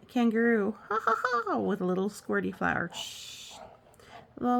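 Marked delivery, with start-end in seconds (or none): 0.9-1.6 high playful ha ha ha; cartoonish laugh; mock laugh. Kidding kangaroo laughs. 2.9-3.6 soft unvoiced shush. Shushing sound.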